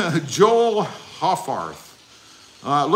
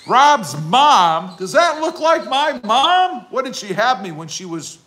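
high, incredulous, louder; mock outrage; 8 semitones higher, 4 dB louder than usual. Real, not acted. Streamer reacting to being called Rob's mom, indignant high pitch. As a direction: pitch up in mock offence at the mix-up.

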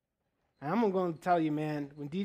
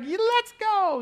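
wife agreeing; 14 semitones higher, 6 dB louder, slower than usual. Retold or imitated. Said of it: "She's like".

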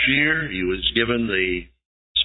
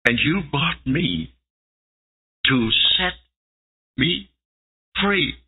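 rising higher in wonder; slow, then climbing higher; astonished disbelief; 4 semitones higher, slower than usual. Acted. Slave woman realizes she's free.

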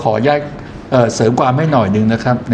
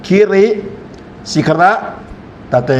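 "คิริ", "สิคระ", "ตะเต" each set apart by a pause; paced by word; 5 semitones higher than usual. Shown as a demonstration. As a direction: read word by word, pausing after "คิริ" and "สิคระ". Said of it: "อ่านเว้นจังหวะให้เหมาะ".

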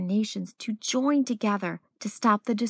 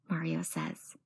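ordinary delivery

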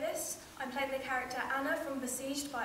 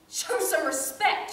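scolding mother; 4 semitones higher, 6 dB louder than usual. Acted. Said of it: "she says".

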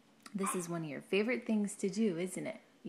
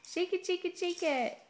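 playful encouragement; 10 semitones higher, 3 dB louder than usual. Real, not acted. Parent asking baby to shake it, high and playful.

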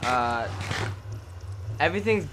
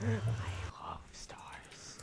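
whisper; 8 semitones higher, 11 dB quieter, slower than usual. Real, not acted. Soft aside while touring.

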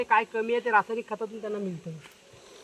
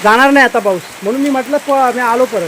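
loud, raised voice over pouring rain; calling over the rain; 13 dB louder than usual. Real, not acted. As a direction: shout above the downpour. Shouting over heavy rain.

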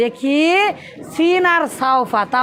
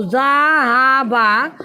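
drawn out word; 3 semitones lower, much slower than usual. Shown as a demonstration. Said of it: "এই যে জাহাবা ইয়াজাহাবু".

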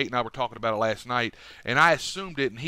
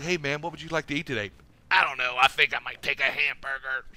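deep-voiced woman; 2 semitones higher than usual. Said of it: "she has a deeper voice than you do".

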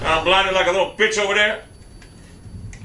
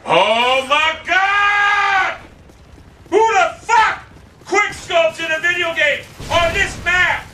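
outraged shout; 10 semitones higher, much slower than usual. Real, not acted. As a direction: howl it in disbelief. Raging at a quick-scope kill.

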